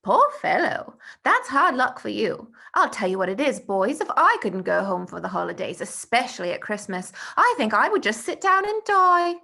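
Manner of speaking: put-on eager young boy Bertie, showing off; eager schoolboy. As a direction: brag about the holidays like an excited schoolboy. Bertie pities Tom.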